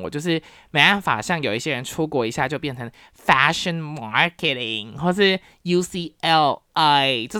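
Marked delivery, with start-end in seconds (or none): 3.1-7.3 exaggerated American-born Chinese accent, drawn out; ABC accent. Someone back from abroad showing off, in an ABC accent. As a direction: put on a slow, showy ABC American accent. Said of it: "就很有A B C腔".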